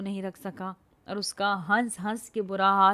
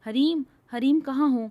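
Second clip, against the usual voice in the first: raised voice calling her name; 5 semitones higher, 6 dB louder than usual. A narrator's reading. Man searching the forest calls out for Hareem. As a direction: call out anxiously across the forest.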